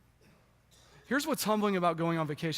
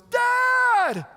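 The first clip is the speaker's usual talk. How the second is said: put-on much higher, much louder gleeful child's yell; kids screaming; 10 dB louder than usual. Small kids greeting dad at the front door, screaming gleefully. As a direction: shout it like a toddler at the door. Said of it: "they scream at the top of their lungs".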